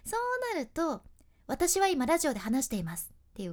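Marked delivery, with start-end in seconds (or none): 1.5-3.1 「私は今ラジオで話しています」を速く音をくっつけて; rushed. Shown as a demonstration. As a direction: run the sounds together fast and louder. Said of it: "隣の黒い丸とくっついちゃったり". (音がくっついた早口)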